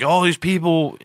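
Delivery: quoting a German friend in a lightly put-on German accent. German friend marvels at baseball, light German accent. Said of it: "picture me in a German accent".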